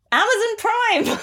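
high, loud excited plug; excited shout. Real, not acted. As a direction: shout the name out proudly. Excited plug of her film.

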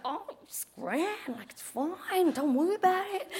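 drawn-out, dismissive reassurance; unbothered mate. Retold or imitated. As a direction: imitate a mate brushing it off softly. Imitates Max brushing off danger.

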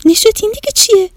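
high, quick astonished question; amazed child. Acted. Shadi asking her brother, astonished. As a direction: gasp it out, wide-eyed. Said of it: "دهنش از تعجب باز مونده بود گفت".

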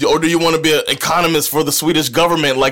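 loud, high mocking father; stern father. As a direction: sneer at your son loudly. Playing the father.

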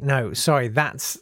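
ordinary talk

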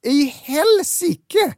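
slow, high, stretched-out dismay; drawn-out disbelief. Retold or imitated. Horror at beige sweater.

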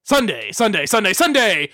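monster truck ad. Pretend. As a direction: yell it like a monster truck commercial.

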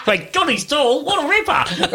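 high, drawn-out hammed-up Aussie; mock Australian accent. Mimicking the woman on the show, mock Australian accent. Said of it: "I'm just doing the accent".

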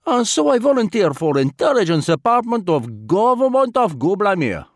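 put-on lightly accented, formal and unhurried Vladimir; a polite spy choosing words with care; foreign diplomat voice. A narrator's reading. Vladimir's long explanation voiced.

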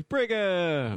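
punchy shout-out of Brigham; called out. Shown as a demonstration. Host announcing his co-host's name, called out. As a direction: belt out Brigham with a flourish. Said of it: "that was shit".

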